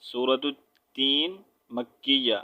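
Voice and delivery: slow chanted surah name; recited title. Shown as a demonstration. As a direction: intone the title slowly. (آہستہ تلاوت کے انداز میں)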